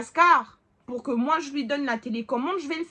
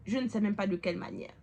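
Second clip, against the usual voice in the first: ordinary talk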